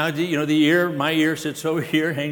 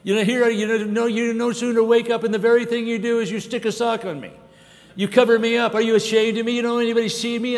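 high, loud whiny complaint of a foot; complaining foot; 8 semitones higher, 4 dB louder than usual. Retold or imitated. Preacher voicing the foot begging the body for relief, high and whiny. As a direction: whine as the overworked foot, much higher. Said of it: "if the foot would say".

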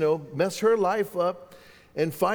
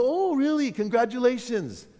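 acted surprise; 7 semitones higher than usual. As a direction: replay a clueless, upbeat reaction. Clueless reply to pregnancy news.

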